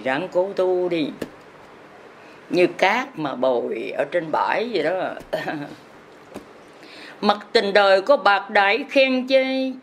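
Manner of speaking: normal talking voice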